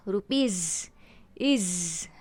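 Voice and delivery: "रुपीज", then the buzzed ending "इज"; buzzing iz ending. Shown as a demonstration. Teacher isolating the plural ending, "रुपीज" and "इज" buzzed. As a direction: hold the z in "रुपीज" and "इज". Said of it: "आईज़ेड का buzzing sound आ रहा है".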